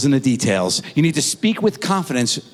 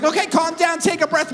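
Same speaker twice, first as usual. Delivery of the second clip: much higher, sing-song calming; high soothing mom. Retold or imitated. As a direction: coo it to calm her down. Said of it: "She's like".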